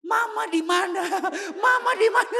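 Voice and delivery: high, loud alarmed questioning; shocked. Retold or imitated. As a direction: demand it in rising comic panic. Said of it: "saya syok banget". (panik, suara tinggi dan keras)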